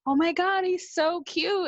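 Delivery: high, breathless gush over a cute guy; giddy high squeal of a crush; gushing excitement. Retold or imitated. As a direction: swoon out loud like a smitten girl. Gushing about first date.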